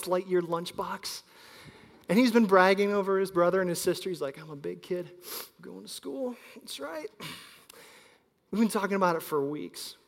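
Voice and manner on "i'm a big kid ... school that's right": a hint of a proud four-year-old; lightly voiced little kid. Son bragging, kid voice.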